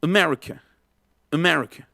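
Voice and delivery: "America" as "uh-MER-ica", ends barely open; schwa. Shown as a demonstration. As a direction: reduce first and last A in "America". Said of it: "No abren la, la boca totalmente".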